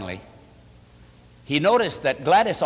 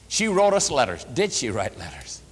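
ordinary talk

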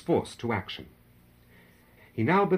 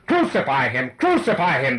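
loud, raised mob chant; frenzied cry; 4 semitones higher, 8 dB louder than usual. A narrator's reading. The crowd, stirred by talk of release, cries out in frenzy. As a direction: shout it twice in a frenzy. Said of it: "they cried".

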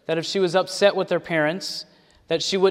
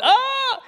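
sudden squeal, far higher; mock shriek of fright. Preacher mimics the campus shrieking in fright. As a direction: yelp like a startled crowd. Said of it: "everybody went".

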